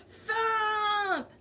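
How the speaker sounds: high, shouted sound effect; big sound word. A narrator's reading. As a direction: belt out the crash noise.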